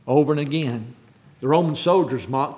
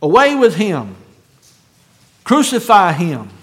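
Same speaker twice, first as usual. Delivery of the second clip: loud, emphatic mob cry; 6 dB louder than usual. Retold or imitated. Preacher quotes the crowd condemning Jesus, crying out. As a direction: cry it out like a crowd demanding a sentence. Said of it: "they cried out".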